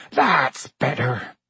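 slow, drawn-out rustic relief; big rustic character, relieved; refreshed giant. A narrator's reading. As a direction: draw it out like a big man just doused. Hagrid refreshed after dousing his head.

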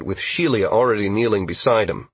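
plain reading voice

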